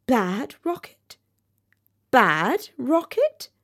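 slow, disbelieving questions with rising ends; incredulous questioning. A narrator's reading. Rocket whirling through the air, puzzled and incredulous. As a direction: draw each word out as a puzzled question. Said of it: "he said as he whirled through the air".

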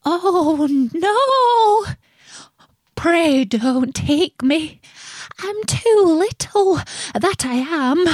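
high-pitched, pleading billy goat; pleading little goat. A narrator's reading. Littlest goat begs troll to spare him, high pleading. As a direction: beg in a small high voice.

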